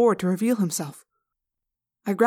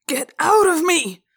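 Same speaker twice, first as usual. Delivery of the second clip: slow, high, loud scream; sudden scream; 10 semitones higher, 7 dB louder, slower than usual. A narrator's reading. Possessed teacher fighting for control, screaming. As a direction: scream it out, stretching the words. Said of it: "Mister Pardew screamed suddenly".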